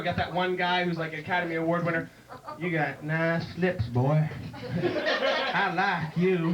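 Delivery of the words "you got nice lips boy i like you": slow, drawn-out backwoods voice; creepy hillbilly. Acted. Deliverance hillbilly to his victim, creepy drawl. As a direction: drawl it slowly like a movie hillbilly.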